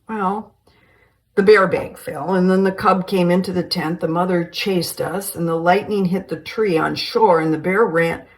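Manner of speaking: loud breathless blurted account; excited rush. Acted. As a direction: pour it all out at once. Dwayne blurts out what happened.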